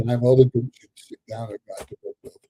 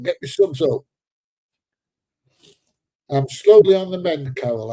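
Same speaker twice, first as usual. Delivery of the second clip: ordinary talk, nothing marked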